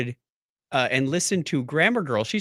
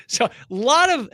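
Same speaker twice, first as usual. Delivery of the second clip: usual speaking voice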